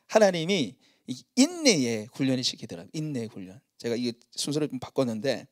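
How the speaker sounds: ordinary delivery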